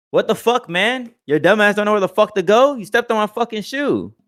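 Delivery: high, loud outraged yell; angry stranger yelling. Retold or imitated. Stranger yells at clumsy shopper over his shoe, high and loud. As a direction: yell at him for stepping on your shoe.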